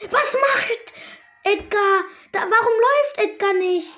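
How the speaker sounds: high, loud, bewildered cry; panicked disbelief. Real, not acted. Gamer yelling at his Edgar that won't move, high and panicked. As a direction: yell at your frozen character.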